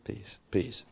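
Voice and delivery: calm them with a near hush; gentle hushed peace. Retold or imitated. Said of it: "He said".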